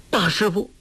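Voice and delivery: high, pleading tearful call; tearful cry. A narrator's reading. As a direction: call out to your master, half crying. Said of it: "郭靖哭道". (带着哭腔喊)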